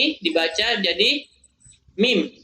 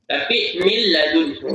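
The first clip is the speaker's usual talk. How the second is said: usual speaking voice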